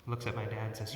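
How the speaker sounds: usual speaking voice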